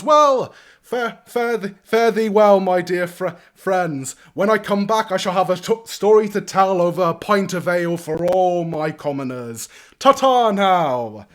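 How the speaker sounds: high, booming lordly send-off; grand farewell. Acted. Young lord calls farewell riding off, loud and exuberant. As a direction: call out a pompous goodbye.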